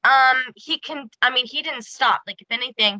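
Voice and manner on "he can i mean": ordinary talk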